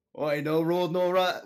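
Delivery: bad Irish accent, unsure of it; attempted Irish accent. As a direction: have a clumsy go at Irish. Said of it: "can you do your Irish accent then".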